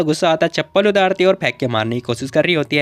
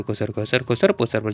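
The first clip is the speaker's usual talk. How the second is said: much softer and lower whispered murmur; hushed khusur-pusur sounds; mock whispering; 6 semitones lower, 5 dB quieter than usual. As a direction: imitate secret ear-whispering. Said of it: "कान में खुसुर पुसुर".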